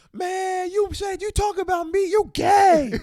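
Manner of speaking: very high-pitched hollering; mock student outburst. Student yelling back at the teacher, high mock shout. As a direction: squeal it, building to a shout.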